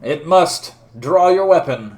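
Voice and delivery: forceful in-character challenge; dueling command. Acted. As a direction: order the opponent to arms. Knight demands a duel.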